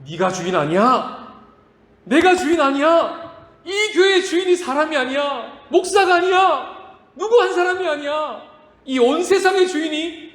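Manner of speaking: high, loud, slow proclamation; proclaiming preaching. Acted. Pastor proclaims who is master, loud and slow. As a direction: declare it to the whole church.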